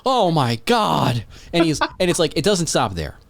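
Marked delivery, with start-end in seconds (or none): 0.0-1.2 slow, drawn-out, higher oh my God; mock disbelief, stretched out; stunned reaction. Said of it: "It's just like".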